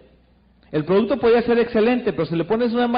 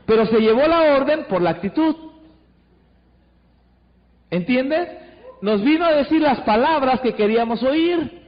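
ordinary delivery

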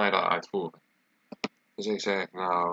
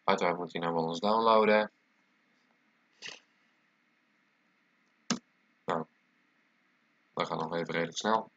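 blocked nose from a cold; talking through a stuffy nose. Real, not acted. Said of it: "ik ben verkouden, zoals je waarschijnlijk kunt horen aan mijn stem".

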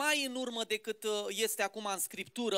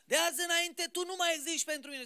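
higher, shouted accusation, dragged out; a man who has lost everything and screams his grievance upward, pitched high; pretend raging at God; 6 semitones higher than usual. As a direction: rail at God like a betrayed believer. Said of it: "n-a urlat, n-a zbierat la Dumnezeu".

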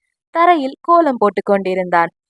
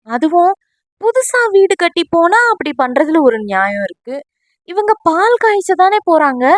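baffled questioning; 7 semitones higher than usual. Acted. Friend baffled about why a calf is coming, high and incredulous.

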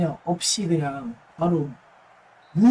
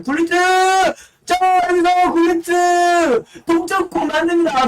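esports caster; 8 semitones higher, 4 dB louder than usual. Acted. Goal call in live commentary.

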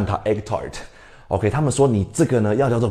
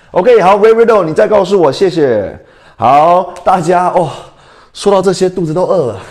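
ordinary talk

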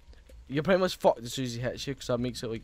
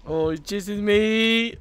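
scared horror game streamer; 7 semitones higher, 6 dB louder, much slower than usual. Real, not acted. Being chased in horror game.

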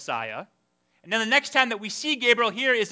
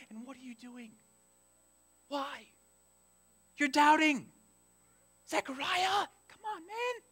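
high, very soft disbelief; exasperated pleading; 6 semitones higher, 8 dB quieter than usual. Acted. Reader begging doubting Zechariah, soft high exasperation.

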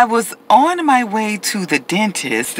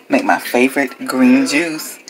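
normal talking voice

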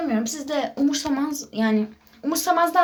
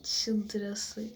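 whisper; 5 semitones lower, 13 dB quieter than usual. Real, not acted. Whispering in hiding.

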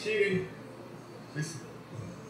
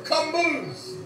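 ordinary talk, nothing marked

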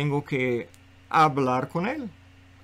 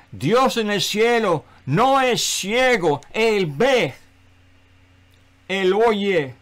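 slow, raised preacher's proclamation; emphatic pulpit declaration, higher and louder; forceful preaching; 4 semitones higher, 3 dB louder, slower than usual. Real, not acted. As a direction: proclaim it with weight, punching each phrase.

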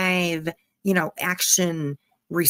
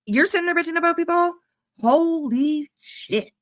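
incredulous outburst; 9 semitones higher than usual. Real, not acted.

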